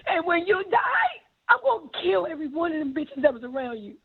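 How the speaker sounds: high-pitched, crying outburst; tearful fury. Real, not acted. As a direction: cry out in anguished anger, stretching the words. Reliving a tearful, furious confrontation.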